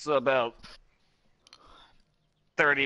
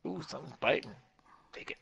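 whispering; 7 dB quieter, faster than usual. Real, not acted. Quiet excitement at a bite.

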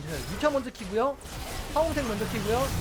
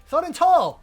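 taunting shout; 7 semitones higher, 10 dB louder, much slower than usual. Real, not acted. Taunting beaten enemy team.

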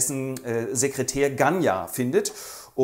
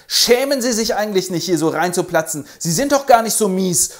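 loud, higher scolding; earnest rebuke; 6 semitones higher, 7 dB louder than usual. Acted. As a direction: call her to order. Fürst tadelt Nastassja.

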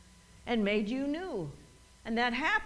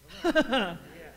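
short burst of laughter; scoffing laugh; 3 semitones lower than usual. Shown as a demonstration. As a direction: laugh off the challenge. Laughing off the devil's challenge.

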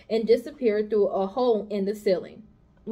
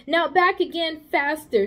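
commanding; 6 semitones higher, 3 dB louder than usual. Acted. Charlotte commands the running pig.

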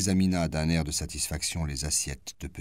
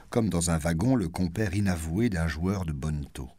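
plain reading voice

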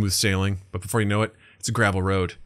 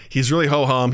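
normal talking voice

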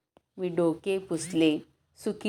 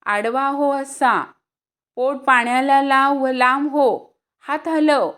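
loud, higher coaching calls; shouted instructions; 7 semitones higher, 8 dB louder than usual. Acted. Boys shouting swimming instructions to Shyam, loud coaching calls. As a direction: call the strokes to a panicking swimmer.

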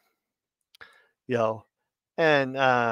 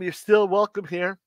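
normal talking voice

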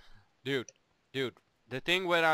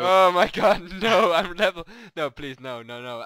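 loud panicky begging; mock terror, begging for mercy; panicked pleading; 2 semitones higher, 14 dB louder than usual. Panicked pleading when threatened.

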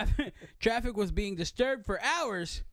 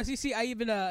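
usual speaking voice

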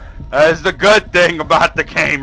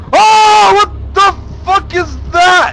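very high, loud scream of shock; startled scream; 10 dB louder, much slower than usual. Real, not acted. Player jolted by the horror game, screaming. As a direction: shriek it out at a sudden scare.

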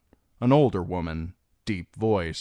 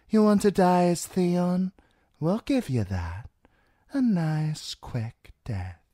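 put-on high, lilting accented voice; coaxing washerwoman; 6 semitones higher than usual. A narrator's reading. Rowan offers Theon quick death, coaxing. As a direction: promise death soothingly in a high character voice.

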